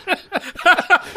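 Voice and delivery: a sudden high burst of laughter; short high laugh after the punchline. Real, not acted. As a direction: laugh at your own rant. Laugh at his own paranoia.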